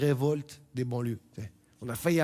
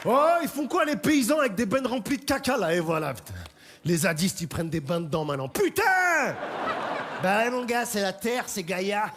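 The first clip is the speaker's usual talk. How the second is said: shouting onlooker; 7 semitones higher, 3 dB louder than usual. Acted. Shouting protester voice.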